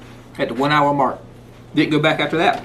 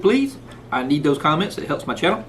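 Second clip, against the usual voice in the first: ordinary talk, nothing marked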